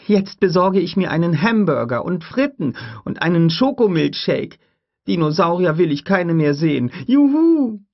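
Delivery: put-on high, loud gleeful child voice; a kid celebrating her freedom; gleeful girl. Lex looks forward to a hamburger, high gleeful child voice. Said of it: "rief Lex".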